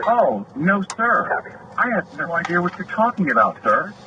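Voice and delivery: high-pitched mocking "no, sir"; smirking fake innocence. Officer feigns ignorance to the complaining deputy, smirking. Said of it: "he rolled his eyes and smiled and goes".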